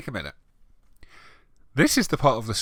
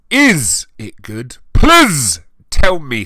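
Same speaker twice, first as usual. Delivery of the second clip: high, loud, slow whiny plea; mock needy author; 6 semitones higher, 13 dB louder, slower than usual. Reader mocking the fanfic author's plea for reviews. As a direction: ham up a desperate fanfic writer begging.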